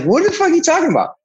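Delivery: a high-pitched what-the-hell, hurried; incredulous outburst. Retold or imitated. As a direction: blurt it out in total shock. Retold disbelief at shocking news.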